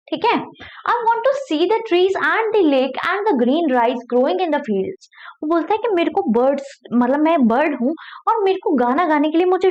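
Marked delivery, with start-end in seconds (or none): 0.9-5.1 slow, careful read-aloud of a story. Acted. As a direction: read each word slowly for learners. Reading the English story aloud. (धीमी कहानी पढ़ाई)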